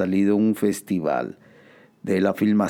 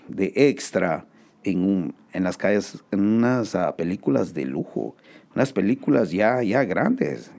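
ordinary talk, nothing marked

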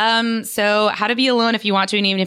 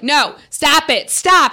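dog owner scolding; 7 semitones higher, 4 dB louder, slower than usual. Retold or imitated. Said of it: "They're like".

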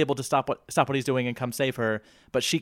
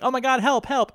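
high, hammed-up damsel calling for help; mock cry for help; 10 semitones higher than usual. Ranger girls mocked as helpless damsels, high mock cry.

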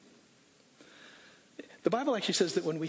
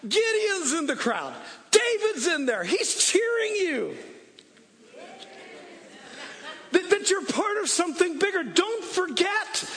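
rally the church like a coach at a game; full-force preaching; 11 semitones higher than usual. Acted. Preacher quotes Hebrews at full force.

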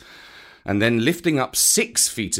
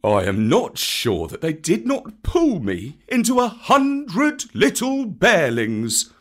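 grave Baloo; 6 semitones higher, 3 dB louder than usual. A narrator's reading. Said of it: "said Baloo gravely".

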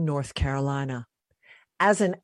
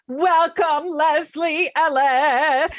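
drawn-out, louder announcer welcome; big welcome; 9 semitones higher, 5 dB louder, much slower than usual. Real, not acted. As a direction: announce her name like a show host. Host introducing guest, drawn out.

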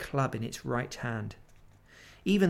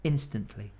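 ordinary delivery, nothing marked

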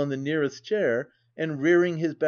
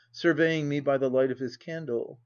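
ordinary delivery

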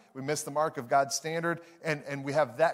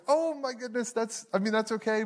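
higher-pitched imitation of a dismayed wife; lightly acted dismay, pitched up; 5 semitones higher than usual. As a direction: play a wife trying to be okay with it. Wife's reaction to forgotten coupon.

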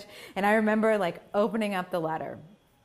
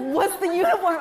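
much higher, louder puzzled mom; mom's voice; 12 semitones higher, 9 dB louder than usual. Retold or imitated. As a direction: imitate mom asking. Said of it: "She was like".

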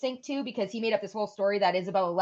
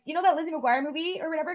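quick, higher casual question to mom; asking mom casually; 8 semitones higher, faster than usual. Retold or imitated. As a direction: toss the question out lightly. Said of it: "I was like".

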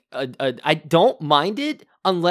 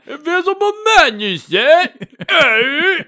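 high, loud comic exclamations; hammed-up film villagers; 10 semitones higher, 7 dB louder than usual. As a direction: caricature a baffled constable and alarmed locals. Acts out villagers and befuddled constable.

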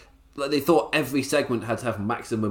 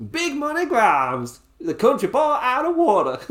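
put-on high, slow country-boy accent; 12 semitones higher, 4 dB louder than usual. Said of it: "Do I have to stop that accent?".

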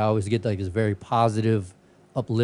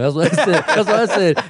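said through real laughter, higher and louder; laughing; 8 semitones higher, 8 dB louder than usual. Real, not acted. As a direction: laugh out the words while repeating them. Laughing retelling of own comeback.

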